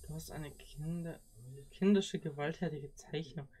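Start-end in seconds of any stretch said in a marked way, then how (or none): none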